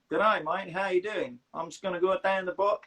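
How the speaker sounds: put-on Aussie accent; amateur Australian impression. Shown as a demonstration. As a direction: greet a mate like an Australian. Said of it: "Australians is probably the easiest to do".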